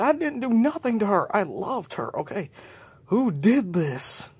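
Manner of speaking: high, loud desperate denial; pleading protest. Acted. J J pleads with accusers, high and loud. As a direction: insist on innocence, slowing at the end.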